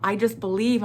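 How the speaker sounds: ordinary talk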